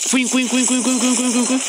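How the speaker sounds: high, repeated squeaky cuin noises; imitated squeaks. Streamer mimicking the game, high squeaky chirps.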